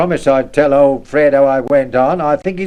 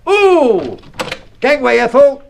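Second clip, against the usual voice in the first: high, loud rushing cry; urgent shout; 10 semitones higher, 3 dB louder, slower than usual. Acted. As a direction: yell as you dash past. Rushing past his wife.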